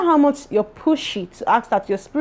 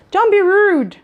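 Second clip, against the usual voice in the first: firm scolding; 9 semitones higher, 4 dB louder, slower than usual. Real, not acted. Scolding viewers for rudeness.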